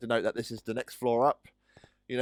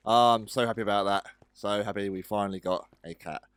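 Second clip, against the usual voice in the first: normal talking voice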